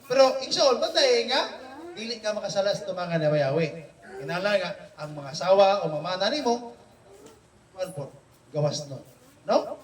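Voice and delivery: normal talking voice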